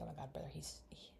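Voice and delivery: hushed, breathy disbelief; shocked whisper. Retold or imitated. A girl retells her shocked reaction in a whisper. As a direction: whisper it like you can't believe him. Shocked aside retold as quote.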